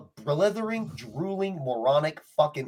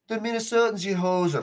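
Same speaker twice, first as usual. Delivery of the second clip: higher-pitched, badly faked Minnesotan-Canadian accent; mock Minnesotan accent; 6 semitones higher than usual. Host mocking Minnesotans he hates, failed Minnesotan accent. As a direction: put on a cartoonish Canadian hoser accent. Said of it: "That's just Canadian".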